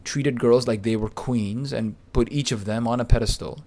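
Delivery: normal talking voice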